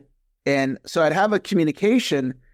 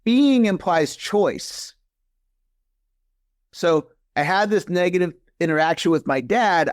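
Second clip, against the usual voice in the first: ordinary delivery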